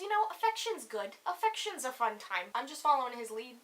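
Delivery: usual speaking voice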